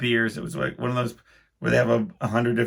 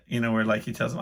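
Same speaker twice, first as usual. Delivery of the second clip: ordinary delivery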